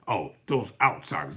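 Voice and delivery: lightly voiced contempt for outsiders. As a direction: sneer it like a Jim Crow official. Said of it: "That's what they said".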